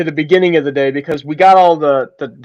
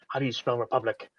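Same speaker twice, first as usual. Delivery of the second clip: much softer, low mumbled question; quiet puzzled mumble; mumbled quote; 4 semitones lower, 12 dB quieter, faster than usual. Retold or imitated. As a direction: ask it under your breath, half to yourself. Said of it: "And he's like".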